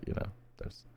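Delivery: whispered aside; whispered quick sheepish aside. Real, not acted. Awkward aside after racism remark.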